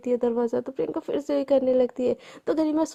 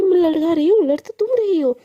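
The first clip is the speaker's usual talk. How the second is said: louder, higher angry retort; angry yelling; 4 semitones higher, 5 dB louder than usual. Acted. Priyanka hitting back in the quarrel with Garima, shouting angrily. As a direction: throw the blame back, shouting. Heated quarrel between roommates.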